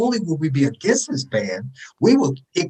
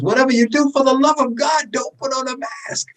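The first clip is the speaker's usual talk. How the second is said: mock official plea; 9 semitones higher than usual. Imitating the CDC warning the public, high mock pleading. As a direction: plead it up high, slowing on the last words. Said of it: "the C D C says".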